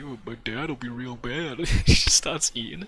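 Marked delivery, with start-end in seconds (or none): 0.0-1.6 imitating reviewer. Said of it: "he spits it out and he's like".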